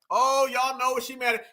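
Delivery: ordinary delivery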